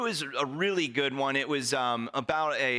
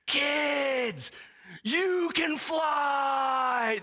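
put-on much higher and louder, slow drawn-out shout to the kids; hyped star to the camera; 4 dB louder, much slower than usual. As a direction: grab the mic and shout it straight at the children. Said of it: "he's like".

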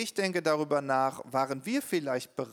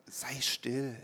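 soft, low hushed command; hushing; 3 semitones lower, 6 dB quieter than usual. Retold or imitated. People around you softly silencing your voice. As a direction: quietly tell them to be still. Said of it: "gesagt haben".

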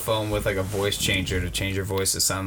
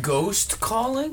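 much higher and very slow, disbelieving; an amazed echo of a ridiculous claim; incredulous; 11 semitones higher, much slower than usual. Real, not acted. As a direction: stretch it out in total disbelief. Friend baffled by absurd excuse.

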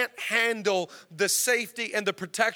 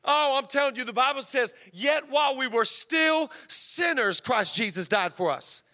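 raised, driving revival-preacher delivery; fervent preaching; 4 semitones higher than usual. Real, not acted. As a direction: hammer each phrase home to the congregation. Preaching at full force.

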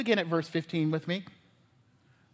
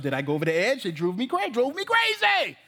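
mock exasperation; 2 semitones higher than usual. Parent questioning himself after yelling at the child, mock exasperation. Parent justifying self, building outburst.

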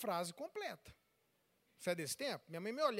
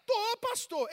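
high, loud insistent reply; imitated defiant parent; 11 semitones higher, 6 dB louder, slower than usual. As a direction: answer the pastor insistently. Answers as angry father.

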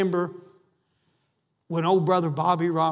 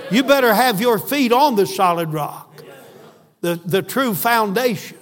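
loud, rising preacher's cry, words driven hard; preaching at full force; 3 semitones higher, 6 dB louder than usual. Acted. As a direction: preach it out to the congregation, louder.

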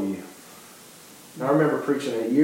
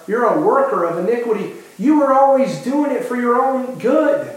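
higher, louder stern rebuke rising to a cry; stern judging Lord; 7 semitones higher, 4 dB louder than usual. Acted. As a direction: condemn them, louder on "glory". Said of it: "And he's".

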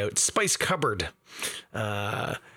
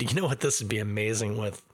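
ordinary delivery

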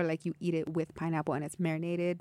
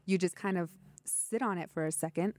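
normal talking voice

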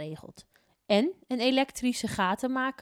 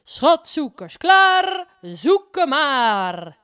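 loud, high announcer call; announcer over speakers; 7 semitones higher, 13 dB louder, much slower than usual. A narrator's reading. Announcer calling the treasure hunters to start, loud and high. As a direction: boom it out like a fair announcer. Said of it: "Door de boksen klinkt een stem".

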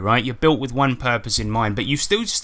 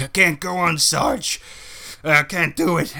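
dying soldier; 2 semitones higher than usual. Acted. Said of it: "like in a film".

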